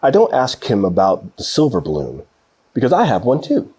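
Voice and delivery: usual speaking voice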